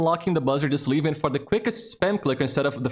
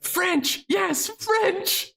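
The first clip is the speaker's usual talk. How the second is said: much higher, delighted mock squeal; excited squeal; much slower than usual. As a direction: squeal like she just heard her favourite topic. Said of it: "she's like".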